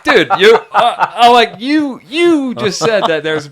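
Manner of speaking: loud, high, laughing disbelief; incredulous outburst. Retold or imitated. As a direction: burst out in amused, exasperated protest. Retelling his incredulous outburst, laughing.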